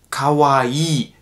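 Kawaii slowly with a lengthened I; long I. Shown as a demonstration. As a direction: hold the double I in Kawaii. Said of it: "dann wird das I immer verlängert". (Kawaii mit verlängertem I)

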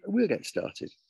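high, sing-out teacherly instruction; coaxing teacher. Acted. Teacher pushing the new boy to remove his hat, high coaxing. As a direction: lift the pitch and make the order sound easy. Teacher pushes her advantage.

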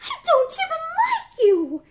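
high, furious, trembling voice; acted outburst, voice shaking and rising; shaking with anger. A narrator's reading. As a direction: build to a loud, stammering, angry rejection. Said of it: "Her voice shook with anger now".

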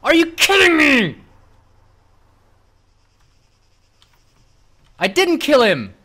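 loud, high disbelieving shout; outraged yell. Real, not acted. Killed in game, outraged.

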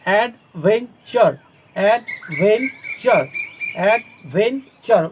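"অ্যাডভেঞ্চার" very slowly, one syllable at a time; split into syllables. Shown as a demonstration. Teacher drilling pupils, "অ্যাডভেঞ্চার" syllable by syllable. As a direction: draw out each piece of "অ্যাডভেঞ্চার". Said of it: "ওগুলো সিলেবেলে ভাগ করবে".

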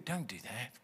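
low, very soft warning; inner thought voice. Acted. Preacher voicing the thought that warns you off, low and hushed. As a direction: drop right down to imitate a discouraging thought. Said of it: "It will tell you".

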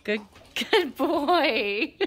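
slow high laughing praise; squeaky praising a dog. Real, not acted.

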